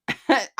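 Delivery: short amused laugh. Real, not acted. Host laughing at a viewer comment.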